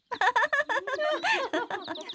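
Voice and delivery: mocking giggle. Roses laugh at prince.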